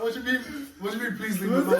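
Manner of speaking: mock the plea while cracking up; laughing at his own comeback. Retold reply, laughing.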